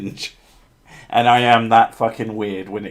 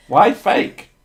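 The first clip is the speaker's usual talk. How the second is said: indignant; 11 semitones higher, 7 dB louder than usual. Real, not acted. Mock outrage at fake spiders.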